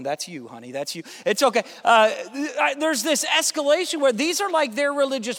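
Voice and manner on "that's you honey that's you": parents teasing. Retold or imitated. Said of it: "I see some parents out there going".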